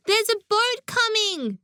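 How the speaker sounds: high-pitched excited call; crying out. A narrator's reading. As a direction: cry out the news in a high voice. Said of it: "cried Lisa".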